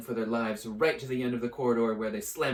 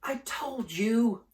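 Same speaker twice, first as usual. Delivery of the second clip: out of breath, pushed high; breathless gasp; 12 semitones higher, 5 dB louder, slower than usual. Acted. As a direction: gasp it out after running. Hermione gasping after running.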